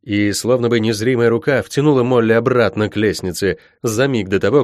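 ordinary delivery

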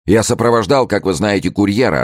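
high-pitched, urgent account; agitated report. A narrator's reading. Gourville who escorted the courier reporting, agitated. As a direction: blurt out the news.

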